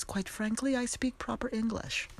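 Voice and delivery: playfully hoity-toity; mock proper. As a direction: put on airs of proper English. Mock-proper boast after strict upbringing.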